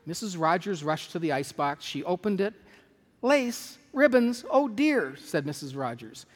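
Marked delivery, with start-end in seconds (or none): 3.2-5.2 high, louder words of disbelief; acted dismay. Employer opens the icebox, acted dismay. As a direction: take each item in with dismay. Missus Rogers finds trimmed meat.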